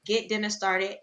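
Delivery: ordinary talk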